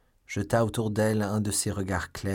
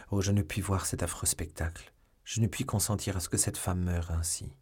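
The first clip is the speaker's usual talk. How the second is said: plain reading voice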